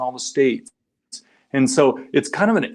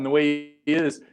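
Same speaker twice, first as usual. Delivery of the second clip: ordinary talk, nothing marked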